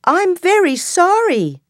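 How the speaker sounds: tiny, high, apologetic mouse; scared mouse. Acted. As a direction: pitch way up like a frightened little mouse. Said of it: "says the mouse".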